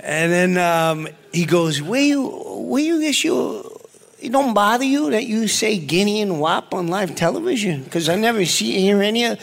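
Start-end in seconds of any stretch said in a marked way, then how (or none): 1.8-9.4 Joe Pesci impression. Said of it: "That's an incredible impression".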